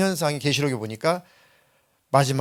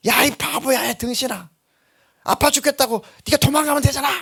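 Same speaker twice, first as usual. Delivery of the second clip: higher, exasperated mother's yell at her son; scolding mother; 9 semitones higher than usual. Retold or imitated. A mother scolding her stubborn son mid-spanking, exasperated and higher. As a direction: shout at the stubborn kid to just run.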